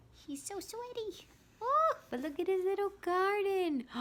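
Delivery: high, cooing delight; cute excited voice. Real, not acted. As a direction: coo over something adorable. Cooing over the picture.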